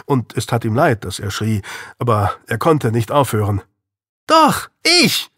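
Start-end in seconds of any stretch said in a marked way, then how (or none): 4.3-5.3 high, loud defiant cry of "Doch, ich!"; shouted back. Acted. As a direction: yell it right back at him. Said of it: "schrie Louis zurück".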